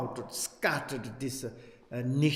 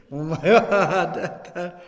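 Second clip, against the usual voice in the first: laughing; 4 semitones higher, 8 dB louder, much slower than usual. Real, not acted. Laughs at complicated explanation.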